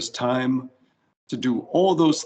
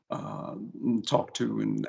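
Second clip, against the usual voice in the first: ordinary talk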